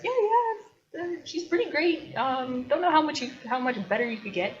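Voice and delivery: high-pitched humouring voice, gradually coming down; hesitant, half-hearted agreement, hammed up; placating poet. Poet humouring the knight about his wife, placating. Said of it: "and the poet is like".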